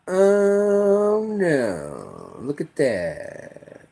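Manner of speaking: slow, soft, fading mock dismay; mockingly feeble onlooker; mock helpless. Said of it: "standing on the sidelines just like".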